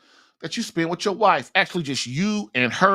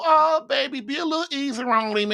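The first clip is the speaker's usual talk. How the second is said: higher, coaxing mock wife plea; pleading wife; 7 semitones higher than usual. Wife defending the kid to her husband, coaxing and higher. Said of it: "they'll try to defend the kid and go".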